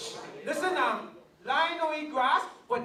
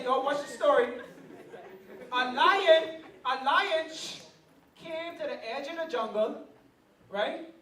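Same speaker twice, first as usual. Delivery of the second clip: usual speaking voice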